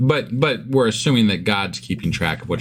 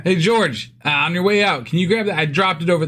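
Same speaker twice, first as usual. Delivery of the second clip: pretend calling out; 7 semitones higher than usual. Plays along, calling out to George.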